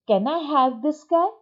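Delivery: put-on child asking. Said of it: "वो पूछता है".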